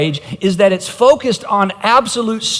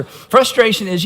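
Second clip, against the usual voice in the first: usual speaking voice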